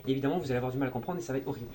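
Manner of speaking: very fast, no pauses; rushed. Shown as a demonstration. Showing viewers speech without pauses, rushed. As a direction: race through without a breath. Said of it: "vous parlez comme ça".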